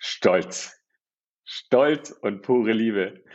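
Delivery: slow, drawn-out mock pride; proud father, imitated. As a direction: stretch each word like a moved dad. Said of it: "Ich so". (langsam, gerührt gespielter Vater)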